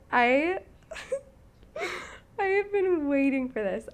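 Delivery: stifled giggles breaking through; barely holding back laughter; trying not to laugh. Real, not acted. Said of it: "you laughing just saying the title".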